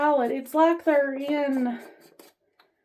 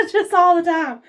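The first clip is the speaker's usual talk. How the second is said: laughing; 4 semitones higher, 6 dB louder than usual. Real, not acted. Laughing at her own restlessness.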